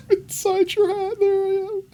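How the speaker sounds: very high squeaky mini-me voice; tiny doll voice. Pretend. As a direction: play the tiny doll hiding in a hat. Voicing tiny doll inside hat.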